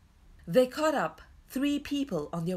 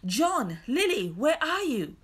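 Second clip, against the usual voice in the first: louder, higher searching call; 7 semitones higher, 5 dB louder than usual. A narrator's reading. Peter calls lost children in wood, calling out. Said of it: "Peter's voice came again".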